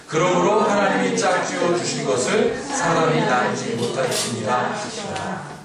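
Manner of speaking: measured scripture reading, drawn out at the end; slow communal reading. Real, not acted. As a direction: lead the congregation reading aloud together. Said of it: "다 함께 천천히 읽어보겠습니다".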